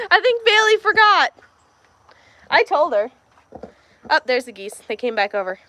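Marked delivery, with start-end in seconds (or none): none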